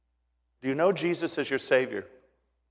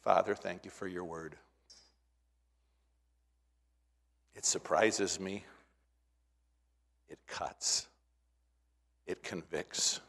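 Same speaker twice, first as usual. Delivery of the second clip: closing prayer; 8 semitones lower, 11 dB quieter than usual. Real, not acted. Pastor praying softly after the sermon, hushed. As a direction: pray quietly and humbly.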